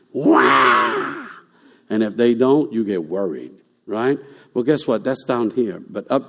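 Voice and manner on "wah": mock newborn wail; short imitated baby wah; baby cry imitation. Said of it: "the first thing you want to hear is them".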